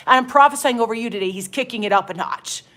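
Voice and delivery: usual speaking voice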